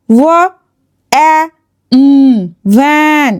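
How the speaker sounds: ওয়া, অ্যা, উম one sound at a time, then ভ্যান blended; sounded-out word. Shown as a demonstration. Teacher showing students the short A, word sounded out slowly. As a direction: break ভ্যান into separate sounds with pauses, short "a". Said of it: "এখানে এ sound কী রকম হলো".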